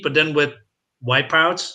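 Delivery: ordinary talk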